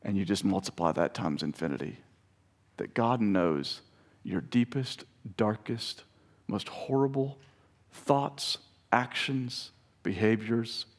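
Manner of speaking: soft, slow, low sermon close; intimate preaching. Acted. Preacher telling the congregation God loves them, softly and tenderly. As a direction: lean in and speak it gently.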